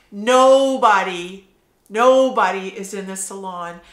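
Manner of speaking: "nobody" drawn out long and loud; emphatic slow "nobody"; stress on nobody. Real, not acted. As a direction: lean hard on "nobody". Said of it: "emphatic repeated nobody".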